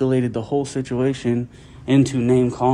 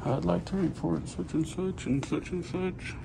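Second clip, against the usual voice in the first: lightly mocking caller, higher and much softer; imitating phone caller; 5 semitones higher, 11 dB quieter than usual. Man filming quietly imitates the staffer reporting him, lightly mocking caller. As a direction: mimic a man phoning in a complaint, under your breath. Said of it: "this dude back here on the phone".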